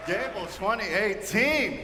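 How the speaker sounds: high, drawn-out shout to the crowd; rousing emcee shout, words stretched out high; hyping the crowd. Real, not acted. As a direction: call out big and slow like an excited host. Host hypes up the crowd.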